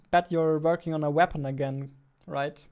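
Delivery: ordinary talk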